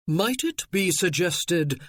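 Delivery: cold, slow aristocratic tone; cool haughty. Acted. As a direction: speak down with icy hauteur. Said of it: "a cool, haughty voice asked".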